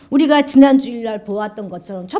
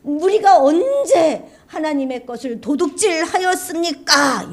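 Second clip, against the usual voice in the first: indignant cry; 9 semitones higher than usual. Acted. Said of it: "이렇게 외쳐요".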